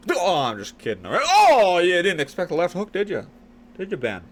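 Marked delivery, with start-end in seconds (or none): 1.3-4.2 high, loud gloating tease; mock taunting. Taunting a viewer about the left hook he didn't expect. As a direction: rub in the surprise hook.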